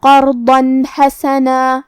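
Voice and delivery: level chant of قرضاً حسنا, clean n before ḥa, long final aa; clear izhar. Shown as a demonstration. Teacher demonstrating izhar, slow tajweed recitation. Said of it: "بغیر غنہ سے یہ حکم کو ادا".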